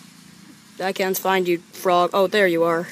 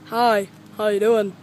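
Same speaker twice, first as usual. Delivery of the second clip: ordinary delivery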